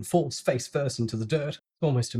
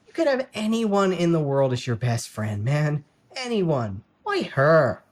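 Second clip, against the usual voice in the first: higher, louder incredulous pleading; exasperated plea; 4 semitones higher, 6 dB louder, slower than usual. A narrator's reading. Blake pleading with his friend about his sister, incredulous. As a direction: beg him to see sense.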